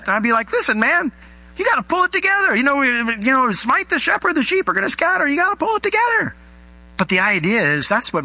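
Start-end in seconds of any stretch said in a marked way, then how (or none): 0.5-6.3 high, urgent exasperated pep talk; upset scolding. Acted. Boss to freaking-out right-hand guy, upset scolding. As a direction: scold in a raised pitch, acting upset. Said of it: "I would probably be a little upset".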